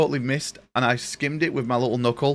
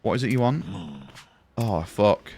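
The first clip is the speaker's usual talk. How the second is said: ordinary delivery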